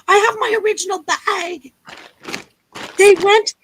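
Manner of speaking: ordinary talk, nothing marked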